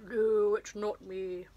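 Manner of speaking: a loud sudden no, then a put-on voice for the rest; imitating an actor's flat denial in a changed voice; Christopher Lee denying. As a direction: Christopher Lee's character protesting his innocence, loud on no. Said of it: "Christopher Lee's like that".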